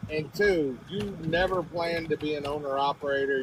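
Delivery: ordinary talk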